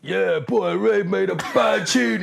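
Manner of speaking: high, loud, drawn-out hype from a fellow DJ; Brian impression. DJ hailing a rival's new tune, mimicked high and loud. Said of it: "That's a very good impression".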